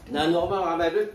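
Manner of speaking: choked, coughing through words; choking on food. Real, not acted. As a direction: talk right after choking. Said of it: "Nasamid ako".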